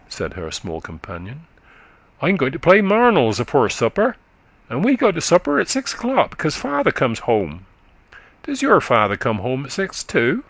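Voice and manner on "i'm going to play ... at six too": put-on high, quick childish voice of a country boy; little boy chatting up to a tired woman, high and eager; chattering little boy. A narrator's reading. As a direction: prattle like a small eager lad. Narrator voices little boy Johnny.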